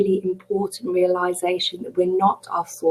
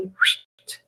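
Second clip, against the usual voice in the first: quick rush of air; imitated whoosh of something flying in; dagger whoosh; 5 dB quieter than usual. Teacher showing the second dagger coming in, imitated whoosh.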